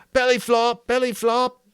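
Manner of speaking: high, loud teasing shout; shouted taunt. A narrator's reading. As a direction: jeer across the pool. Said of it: "He shouted".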